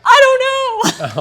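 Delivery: very high, slow, loud half-laughing whine; helpless laughing wail. Retold or imitated. Tells Matt on the phone she has no idea what she needs: helpless laughing wail.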